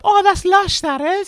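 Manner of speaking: put-on very high, loud Welsh girl squeal; hammed-up Welsh girl, pitched right up; Welsh girl accent.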